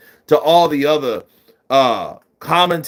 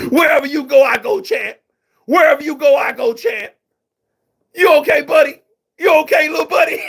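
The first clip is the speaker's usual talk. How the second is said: high, loud hype-man calls; rally your fighter, much higher and louder; Shannon Briggs impression; 11 semitones higher, 3 dB louder, faster than usual. Imitating Shannon hyping himself up, high and loud. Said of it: "We got Shannon the Cannon".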